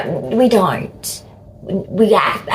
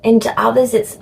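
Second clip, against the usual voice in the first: ordinary talk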